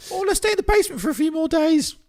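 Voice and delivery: high, loud mocking whine; mock pleading. Speaker mimicking people who'd hide in the basement, mocking. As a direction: put on a high silly voice to ridicule staying. Mocking those who would shelter.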